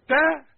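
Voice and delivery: bright "تا" said with spread lips; smiling ta. Shown as a demonstration. Teacher showing ta with a smile, high and loud. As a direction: smile while saying "تا". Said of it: "ابتسم".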